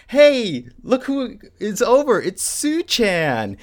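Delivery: high, drawn-out excited greeting; delighted sisters. Retold or imitated. Sisters greet a surprise guest, high and delighted. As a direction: imitate sisters happily announcing a visitor.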